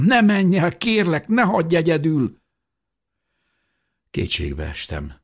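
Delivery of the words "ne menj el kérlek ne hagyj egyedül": high, loud, desperate begging; pleading shout. A narrator's reading. Jósa begs fading Mikál to stay, shouting. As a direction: beg at full voice not to be left. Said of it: "kiáltottam".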